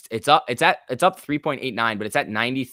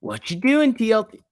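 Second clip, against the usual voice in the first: much higher playful mock scolding; teasing a stock; 10 semitones higher, 4 dB louder than usual. Streamer teases the TLT chart, high playful scolding. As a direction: tease the ticker like a naughty pet.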